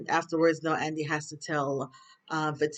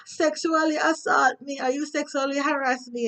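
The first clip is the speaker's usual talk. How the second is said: loud accusing; 10 semitones higher than usual. Retold or imitated. Jacoby accusing Sabrina at the bank, loud and high. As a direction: call her out by name at full volume. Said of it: "being all loud".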